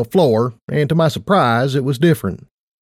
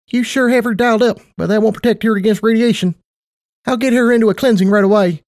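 much higher, perky affectionate mare; perky Tight Lips voice; 9 semitones higher, faster than usual. Acted. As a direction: play a bubbly casino boss.

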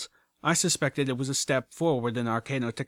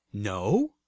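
questioning with a steep final rise; surprised; 3 semitones lower than usual. A narrator's reading. Narrator sits back at the bot's refusal, surprised question. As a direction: echo it back in disbelief.

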